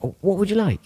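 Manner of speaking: high-pitched imitation of a mate at the bar; Joe. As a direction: ask it in a put-on high voice. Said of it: "It's a good Joe impression".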